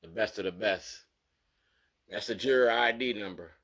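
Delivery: normal talking voice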